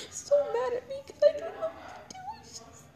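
high, sobbing words with a real cry. Real, not acted. Telling viewers how others get mad at her, sobbing. Crying about people getting mad.

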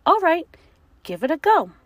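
loud, high teacher voice; teacher monster. Acted. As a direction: encourage the pupil loudly.

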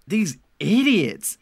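slow, loud and high; mean mutter. Retold or imitated. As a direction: sneer it with real contempt. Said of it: "in a mean way".

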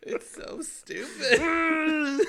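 high, loud, drawn-out laughing words; helpless laughter stretching the last word; cracking up. Real, not acted. Showing a friend a stupid meme, cracking up.

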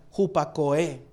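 hupakoe with the final E stressed: hu-pa-ko-É; stress on last E. Shown as a demonstration. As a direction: land the accent on the last E of hupakoe. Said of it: "acento en la última E".

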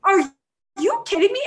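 high, loud incredulous question; stunned disbelief. Retold or imitated. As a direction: act out being flabbergasted. Stunned reaction to being screamed at.

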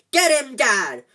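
high, loud cheering yell. A narrator's reading. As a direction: shout him on across the brawl. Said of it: "There was a yell of".

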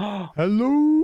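loud high call from above; shouting from crow's nest. Acted. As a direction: holler it down from up high. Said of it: "hollers down from the crow's nest".

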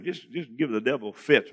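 ordinary talk, nothing marked

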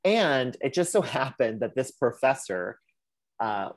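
normal talking voice

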